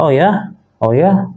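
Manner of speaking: "Oh ya?" pitched high, rising like a question; rising, interested intonation. Shown as a demonstration. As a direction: show interest with a lifted "Oh ya?". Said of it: "affirm interest in what was just said".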